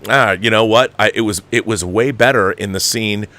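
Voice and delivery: usual speaking voice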